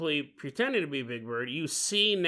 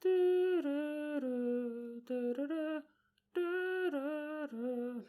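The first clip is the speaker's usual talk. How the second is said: high, soft, drawn-out doo-doo-doo; spooky little tune creeping in, high and slow; eerie hummed jingle; 9 semitones higher, 9 dB quieter, much slower than usual. Acted. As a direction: sing it slowly and quietly from a distance. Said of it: "all of a sudden you're gonna hear".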